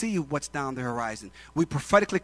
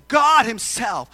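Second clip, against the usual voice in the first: loud, slow, weighty declaration; emphatic declaration; 3 semitones higher, 8 dB louder, slower than usual. Acted. Abraham answers his son about the sacrifice, emphatic. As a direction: declare it firmly, stretching the words. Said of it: "And Abraham says".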